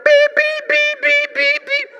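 high, sharp electronic beeps like a security alarm. Pretend. Preacher mimics a door alarm going off: high beeps.

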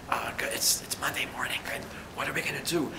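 whispering through a sore throat. Retold or imitated. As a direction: imitate a colleague who can barely speak, just a whisper. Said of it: "Carol was speaking to me like this".

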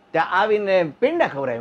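ordinary talk, nothing marked

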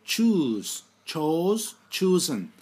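each verb form said loud and separate; loud teacher drill; emphatic drill. Shown as a demonstration. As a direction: call out the forms firmly to the class. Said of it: "birinci, ikinci, üçüncü halleri".

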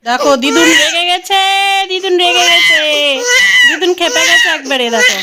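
high, loud, laughing call-out; gleeful loud teasing of grandma; laughing excited teasing. Real, not acted. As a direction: tease her through laughter, loud and high.

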